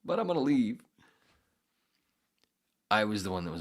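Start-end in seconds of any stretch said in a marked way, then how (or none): 0.0-0.8 retold outburst. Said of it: "And I went".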